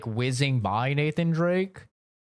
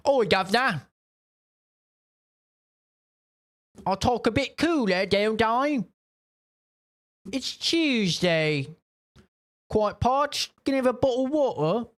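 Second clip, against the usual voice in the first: high, hammed-up British accent, pitched way up; mock British accent; 10 semitones higher than usual. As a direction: lampoon a posh Londoner, much higher than your own voice. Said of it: "He's British, bro".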